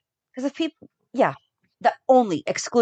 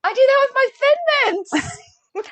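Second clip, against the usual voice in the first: laughing excitedly; slower than usual. Real, not acted. Excited, laughing about Thin Mints.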